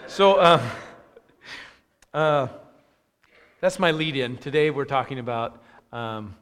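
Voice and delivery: ordinary delivery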